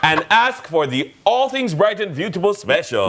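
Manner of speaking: high, bright ad-read voice; ad announcer. Pretend. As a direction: sell it like a radio commercial. Mock ad for the dress.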